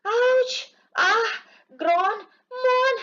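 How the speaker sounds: very high, strained mock cries of pain; hammed-up groans. Tom faking agony to wake Sid, high mock wails. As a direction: wail like a faker in agony. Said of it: "ऐसा बोल रहा".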